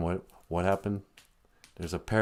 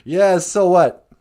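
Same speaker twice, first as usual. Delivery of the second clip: loud, high, drawn-out retort; mock defiance; 9 semitones higher, 10 dB louder, slower than usual. Crowd answering the wicked witch, loudly defiant. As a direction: throw it back at her, big and slow.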